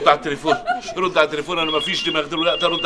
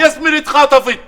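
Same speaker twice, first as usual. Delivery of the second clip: panicked news; 9 semitones higher than usual. Acted. Tells friend daughter kidnapped.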